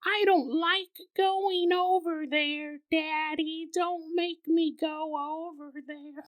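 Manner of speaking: put-on high, slow, pleading child voice; a frightened toddler begs Daddy; small, slow child's plea; scared little girl. Toddler daughter voiced in story.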